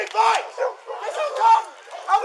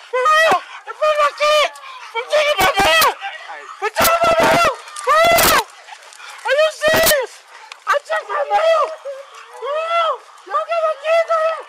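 outraged protest; 6 semitones higher than usual. Real, not acted. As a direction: cry out against the arrest. Man protesting arrest in front of kids.

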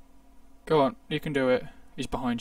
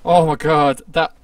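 loud exclamation; 13 dB louder than usual. Real, not acted. Reacting to a bad play.

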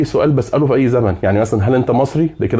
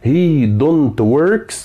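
wrong form, mock question; 2 semitones higher, much slower than usual. Shown as a demonstration. Teacher to students, showing the wrong form: very slow doubtful drawl. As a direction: stretch "he don't works" out very slowly with a doubtful rise. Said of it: "لا، دمها تقيل".

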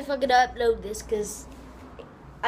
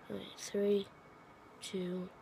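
quiet countdown; 3 semitones lower, 8 dB quieter, slower than usual. Real, not acted. Countdown before running.

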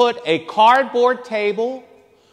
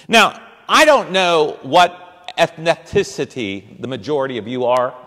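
ordinary talk

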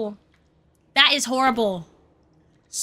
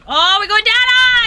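loud, rising cry of alarm; startled yell; 5 semitones higher, 6 dB louder than usual. Real, not acted. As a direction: yelp as the floor suddenly drops away. Suddenly falls in the game.

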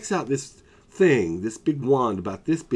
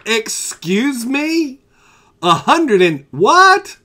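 high, very loud, slow disbelief; shocked outrage; 13 semitones higher, 10 dB louder, much slower than usual. Retold or imitated. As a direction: stretch it out, stunned by the price. Shocked at the bill.

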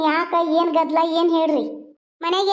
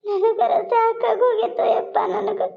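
high, slow, stretched-out mock wail; milk the pretend tears, dragging words out; fake sobbing lament; 3 semitones higher than usual. Mother feigning misery to her son, fake sobbing lament. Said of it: "ಯವ್ವ, ಅಳ್ಬೇಡ".

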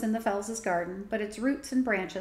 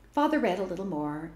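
ordinary delivery, nothing marked

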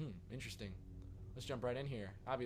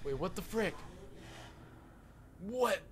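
loud, high disbelief; shocked outcry; 6 semitones higher, 4 dB louder, slower than usual. Pretend. Player seeing his wife killed, shocked. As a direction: burst out, peaking on the last word.

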